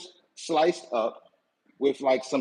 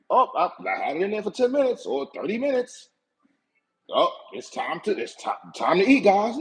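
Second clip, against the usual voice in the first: mocking the careless cook; 4 semitones higher than usual.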